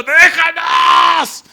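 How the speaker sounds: high, long-drawn, loud screech; demonic shriek. Acted. Demon interrupting Jesus as he teaches, piercing shriek. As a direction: shriek it out, stretching the word. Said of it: "se oye un chillido diciendo".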